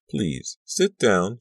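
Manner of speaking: slow, insistent plea. Acted. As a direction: press her to sit, slowly. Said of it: "she insisted".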